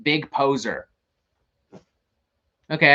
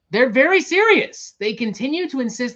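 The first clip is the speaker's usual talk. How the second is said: exasperated outburst; 9 semitones higher, 4 dB louder than usual. Real, not acted. Exasperated emphasis on band.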